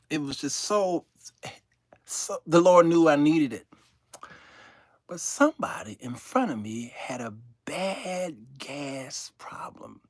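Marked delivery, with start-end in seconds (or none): 5.1-10.0 hushed gossip whisper. Real, not acted. Telling the viewers about a gassy passenger, whispering. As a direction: lean in and whisper it.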